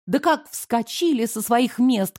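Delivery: ordinary delivery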